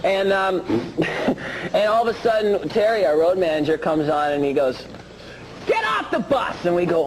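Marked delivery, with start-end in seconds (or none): 5.7-6.6 high-pitched acted shout of command; imitating a yelled order. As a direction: act out the road manager ordering everyone out. Road manager's order, acted out.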